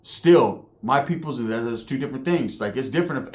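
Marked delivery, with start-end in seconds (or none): none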